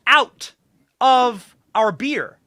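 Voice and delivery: each word slow, high and loud; a drawn-out, forceful plea to leave the beer alone; emphatic demand. Real, not acted. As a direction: spell it out slowly, pounding every word. Guest's emphatic demand about beer.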